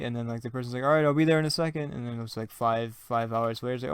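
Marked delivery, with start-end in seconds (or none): 0.8-1.9 quick, louder offhand reply; lightly acted, hurried call back. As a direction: rattle off a busy partner's answer. Acted busy partner answering the call.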